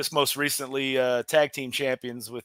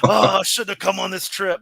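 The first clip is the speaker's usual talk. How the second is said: louder, higher retold panic; distressed complaint; 5 semitones higher, 7 dB louder than usual. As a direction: bemoan the trip, hammed-up. Acts out panicking fellow wrestler's complaint.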